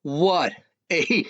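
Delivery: ordinary talk, nothing marked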